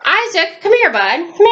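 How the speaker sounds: high sing-song call to the dog. Real, not acted. Calling Isaac the dog over, high and coaxing.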